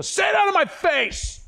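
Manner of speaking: high, explosive yell; mock fighting shout. Dad yelling back at his loving kids, mock fighting shout. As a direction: holler it like playful fighting words.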